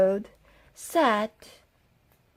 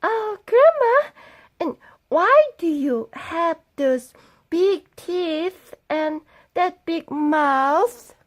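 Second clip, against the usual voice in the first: put-on little girl; 7 semitones higher than usual. Girl asking grandma.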